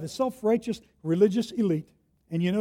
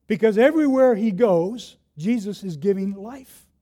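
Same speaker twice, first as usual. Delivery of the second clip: ordinary delivery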